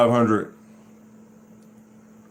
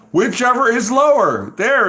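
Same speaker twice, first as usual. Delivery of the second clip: louder, higher eureka emphasis; excited emphasis; 10 semitones higher, 5 dB louder than usual. Real, not acted. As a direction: announce the discovery triumphantly. Finds the key rule excitedly.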